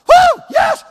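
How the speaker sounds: put-on shrill, loud whoop of a young player; fired-up whoop. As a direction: burst out with a high, loud whoop. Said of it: "they're all fired up".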